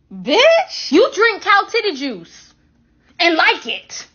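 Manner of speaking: high, loud, drawn-out disbelief; mock outrage. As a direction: fire back in loud mock offence. Mock outrage at being called weird.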